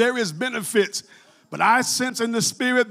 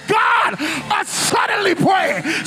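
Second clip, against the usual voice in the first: high, shouted preaching over the music; fiery preacher crying out over the organ; full-force preaching; 7 semitones higher than usual. Real, not acted. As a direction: preach it out at the top of your voice.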